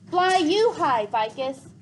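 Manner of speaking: loud, high parting shout; yelled farewell. Acted. Gregor shouting goodbye to Vicus as the bats rise, yelling. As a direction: call it out across the distance. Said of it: "he yelled".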